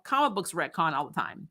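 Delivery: ordinary talk, nothing marked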